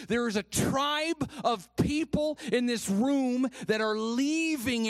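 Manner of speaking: loud, high-pitched preaching with drawn-out key words; impassioned preaching. Real, not acted. Preacher rallying the church against victim mentality, impassioned preaching. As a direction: preach it at full force to the room.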